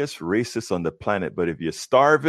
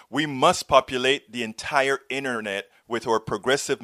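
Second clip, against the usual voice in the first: higher-pitched, earnest outro pitch; channel promo read; 6 semitones higher than usual. Real, not acted. Host asks viewers to subscribe and join, promo read. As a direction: appeal to viewers to support the show. Delivers a scripted subscription appeal.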